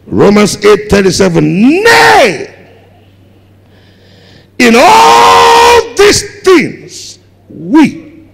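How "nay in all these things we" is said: high, loud, drawn-out preaching shout; preached shout. Real, not acted. Preacher to congregation, slow shouted scripture proclamation. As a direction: stretch every word out like a proclamation.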